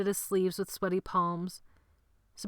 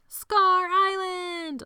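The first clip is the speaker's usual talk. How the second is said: very high, slow, loud group shout; the whole gang yelling it; 13 semitones higher, 7 dB louder, much slower than usual. A narrator's reading. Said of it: "all the boys shouted".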